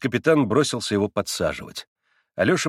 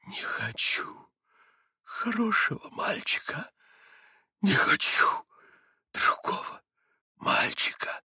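fierce harsh whisper through teeth; wild whisper; 11 semitones higher, 4 dB quieter, slower than usual. A narrator's reading. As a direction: hiss it in wild grief. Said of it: "прошептал он диким шёпотом". (диким шёпотом, скрежеща зубами)

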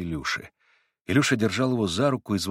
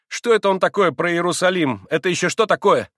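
higher, louder, urgent; agitated boy; 8 semitones higher, 6 dB louder than usual. A narrator's reading. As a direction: press for an answer. Boy demands explanation. (взволнованно)

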